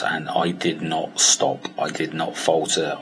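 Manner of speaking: slow, weighty not; determined emphasis. Real, not acted. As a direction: lean on did not, slowly. Stressing unbroken determination.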